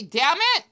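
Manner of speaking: damn it with the N dropped; own damn it. Shown as a demonstration. Demonstrating how she says her own damn it. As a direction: say damn it as one word, no N. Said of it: "the way I pronounce it".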